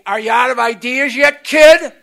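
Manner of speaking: put-on loud, rising jab at the kid; towering sponsor challenging the newcomer, loud; retold loud challenge. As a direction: bark it down at him like a big sponsor. Sponsor confronting him, retold.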